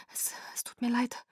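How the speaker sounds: breathless, voiceless whisper; frightened whisper. Acted. Irina apologizes to Kaius in a scared whisper. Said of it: "flüsterte Irina".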